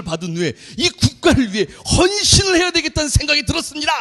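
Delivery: high, loud parade-ground report; disciplined recruit report. Retold or imitated. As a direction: shout it like a stiff new recruit. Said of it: "군기가 바짝 들어와가지고".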